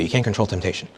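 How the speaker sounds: "can't" leaned on, soft and low; quiet delivery. Shown as a demonstration. Priest reading a retreat line softly to his listeners. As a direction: drop the level and press "can't". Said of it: "Just take that line".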